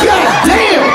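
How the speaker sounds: very high squealed exclamation; high laughing amazement; amazed. Retold or imitated. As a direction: shriek in disbelief. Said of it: "I'm like".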